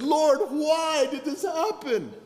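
high, slow comic wail; hammed-up cry to heaven, drawn out; mock anguished plea. Embarrassed preacher asking the Lord why, mock anguished wail. Acted complaint to God.

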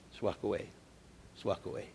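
near-whispered, pleading softly; quiet urging. Real, not acted. Preacher gently urging Renee, whispered advice. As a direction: hush it down to almost nothing.

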